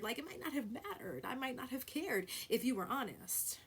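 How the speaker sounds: ordinary talk, nothing marked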